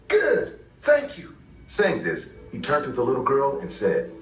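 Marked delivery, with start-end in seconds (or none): 0.1-1.4 high-pitched, acted satisfaction; screaming customer. A narrator's reading. Michael to the worker who backed down, high-pitched and worked up. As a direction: answer high, still worked up.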